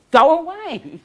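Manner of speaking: loud, high, commanding "Go away"; forceful shout. Shown as a demonstration.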